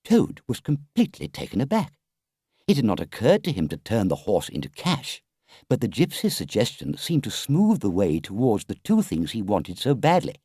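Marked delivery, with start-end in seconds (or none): none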